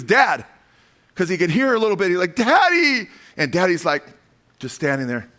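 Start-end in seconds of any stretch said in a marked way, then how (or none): 2.3-3.1 put-on high, loud child's cry for help; desperate cry for daddy. The tortured boy calling out to his dad, a high loud cry. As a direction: scream for your father in pain.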